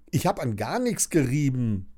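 ordinary delivery

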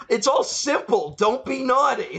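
high exasperated mock rant; hammed-up Santa laying down the rules; Santa going off. Host voicing Santa ranting at captors, high and exasperated. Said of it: "starts going off where he's like".

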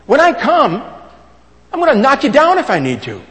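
loud, high-pitched preaching warning; forceful warning. Acted. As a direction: deliver Paul's threat at full volume. Preacher voices Paul's stern warning.

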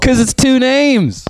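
high-pitched, drawn-out protest; mock outrage. Real, not acted. Producer arguing with sisters about names, mock outrage.

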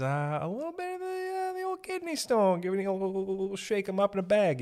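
very high, goofy singsong; silly squeal. Pretend. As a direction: climb into a squeaky cartoonish voice. Clowning about his kidney stone.

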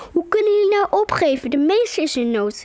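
put-on girl calling after her friends not to give up; called out. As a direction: cry out so the friends stop and listen. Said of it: "riep ik".

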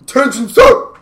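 high, loud call to attention; mock military shout. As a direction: shout it like a movie officer. Quoting movie lines in acted voice.

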